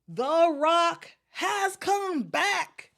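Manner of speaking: high, drawn-out wrestling catchphrase; The Rock. Acted. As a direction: hype it up like a ring entrance. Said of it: "the first thing I think of".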